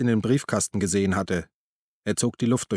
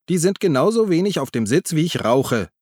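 a loud retort that starts much higher; snapping back; 7 semitones higher, 4 dB louder than usual. A narrator's reading. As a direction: snap it right back at him. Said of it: "schnauzte Uli zurück". (zurückgeschnauzt)

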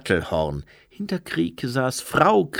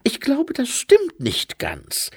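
high, slowing, bewildered objection; puzzled, high-voiced angel; confused angel; 14 semitones higher than usual. A narrator's reading. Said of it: "Der Engel des eisernen Buches wirkte verwirrt".

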